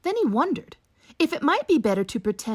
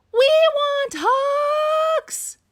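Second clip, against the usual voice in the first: high, loud, slow chant; clamouring crowd; 6 dB louder, much slower than usual. Acted. Animals lined up demanding hugs, loud slow chant. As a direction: drag out the demand.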